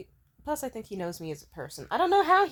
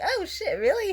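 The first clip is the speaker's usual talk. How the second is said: excited surprise; 13 semitones higher, 7 dB louder than usual. Retold or imitated. Thrilled the date will buy food.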